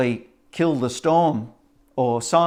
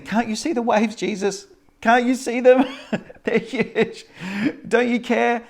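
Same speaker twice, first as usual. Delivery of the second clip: high, half-laughing panicked plea; panicked disciples; 10 semitones higher than usual. Acted. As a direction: cry out at the waves with a laugh breaking in.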